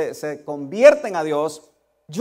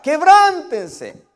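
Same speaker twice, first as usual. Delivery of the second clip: stretched out, much louder and higher; preaching cry; 9 semitones higher, 9 dB louder, much slower than usual. Acted. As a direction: cry it out slowly like a prophet in the desert. Preacher urging repentance. (grito de predicador, lento y fuerte)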